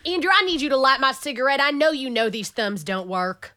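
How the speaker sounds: quick, mock Southern demand; hammed-up Southern smoker; Southern accent. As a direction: nag in a put-on Southern accent. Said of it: "maybe she has a Southern accent".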